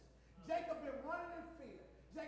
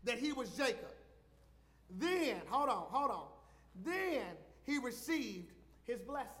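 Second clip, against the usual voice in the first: louder, pounding sermon delivery; full-force preaching; 8 dB louder than usual. Acted. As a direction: drive it home to the congregation.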